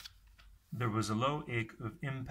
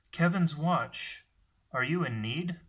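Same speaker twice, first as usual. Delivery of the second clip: higher, louder hail ending in a question; calling out; 6 semitones higher, 6 dB louder than usual. A narrator's reading. A panting voice calls to ask if he is in need, calling out. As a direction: call up to someone far off, raised in pitch. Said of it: "Then a panting voice called".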